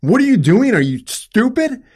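high, loud, exasperated shouting at mom; angry yelling. Retold or imitated. Son pleading with his dying mother, shouting angrily. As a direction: yell it at her, furious and desperate. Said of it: "I was so angry with her".

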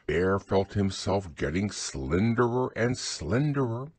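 usual speaking voice

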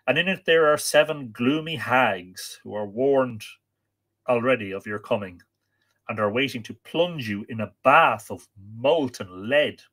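emphatic, swelling storytelling delivery; dramatic story narration. A narrator's reading. Storyteller warning of a perilous journey, dramatic narration. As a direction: lean on the dark words, drawing some out.

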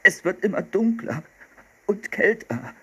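put-on high-pitched whiny boy; whining.

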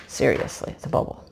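breathy whispered aside, no voice at all. Real, not acted. Whispering to viewers about one small bubble. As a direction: let it fall to a hushed whisper.

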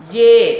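"dê" with a clear ê vowel, pushed loud; read like E. Shown as a demonstration. Teacher corrects the misreading, loud "dê" with ê. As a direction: land hard on "dê", vowel like ê. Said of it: "đọc như âm E".

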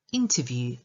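"interview" stressed on IN, long oo at the end; first-syllable stress. Shown as a demonstration. Teacher showing learners how to pronounce "interview", stressed on the first syllable. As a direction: hit the first syllable of "interview", draw out the final U. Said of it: "akcent na pierwszą sylabę".

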